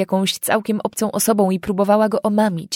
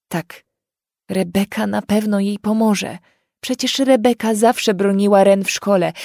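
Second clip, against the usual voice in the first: usual speaking voice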